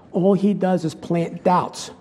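normal talking voice

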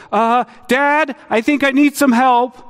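put-on high, urgent child's call for help; a kid pleading for dad's help; distressed child calling. Child calls dad from the hall, high and urgent. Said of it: "came an insistent and distressed voice".

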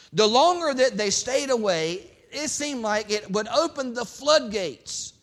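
loud, high preaching voice; full-force pulpit preaching. Acted. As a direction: preach it hard to the congregation.

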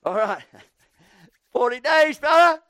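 loud, gloating shout with a laugh; mocking Jonah. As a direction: crow over the city like a spiteful prophet. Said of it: "he's gonna say".

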